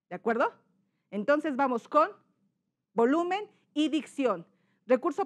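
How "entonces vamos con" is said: ordinary delivery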